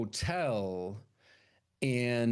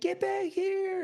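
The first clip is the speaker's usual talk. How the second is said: much higher, slow mock holler; mock yell; slower than usual. Friend calling her back, high mock holler. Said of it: "And they're like".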